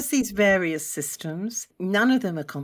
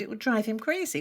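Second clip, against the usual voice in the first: ordinary delivery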